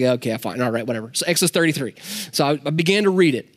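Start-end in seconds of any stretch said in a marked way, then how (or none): 0.0-1.1 low, softer, resigned giving in; muttered, half-hearted okay; grudging consent. Retold or imitated. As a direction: give in reluctantly, dropping pitch and volume.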